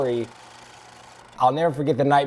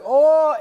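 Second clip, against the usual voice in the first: high, loud imitated "oh" of effort; retold cry; 11 semitones higher, 7 dB louder than usual. Retold cry while stiff-arming someone.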